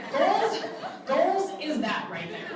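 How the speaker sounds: high, loud and laughing; excited. Real, not acted. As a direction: cheer the word out. Cheering their goals.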